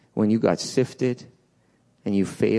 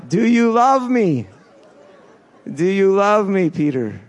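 higher, louder heartfelt plea; Jesus asking; 7 semitones higher, 5 dB louder than usual. Retold or imitated. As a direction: call out the question to Peter. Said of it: "he said, like".

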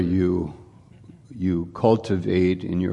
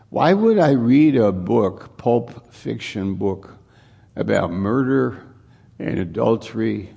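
full-force preaching; 2 semitones higher, 3 dB louder than usual. Acted. Preacher's rhetorical question.

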